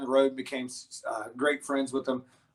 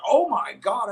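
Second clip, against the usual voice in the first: higher, louder shocked reaction; retold astonishment; 7 semitones higher, 7 dB louder, slower than usual. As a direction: imitate a woman suddenly astonished. Voices lady seeing the bear.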